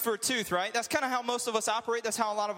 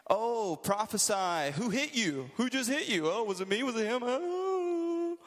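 sing-song, higher mocking taunt; 4 semitones higher than usual. Preacher voicing guards taunting blindfolded Jesus, higher sneering mockery. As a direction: taunt him like a schoolyard bully, slow at first.

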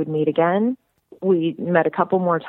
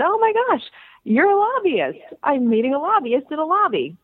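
high-pitched, swooping retold delight; hammed-up thrilled squeal of a fan; mock gushing; 11 semitones higher than usual. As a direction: gush as if meeting a celebrity. Retells her delight at meeting lobbyist.

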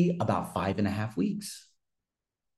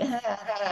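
high-pitched mimicked "ah, ah, ah"; high mocking babble; mocking imitation noise; 11 semitones higher, 4 dB quieter than usual. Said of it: "they were just like".

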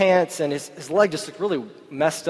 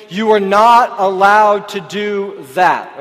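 stern dad warning; 3 semitones higher, 8 dB louder, much slower than usual. Retold or imitated. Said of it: "he said".

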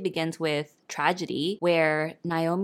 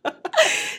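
kind gentle laugh; 5 dB louder than usual. Real, not acted. Laughing at the praise.

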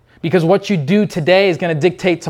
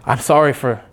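a hint of begging forgiveness; ashamed apology; 6 semitones lower than usual. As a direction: mumble it out in shame, head bowed. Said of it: "they're gonna be like".

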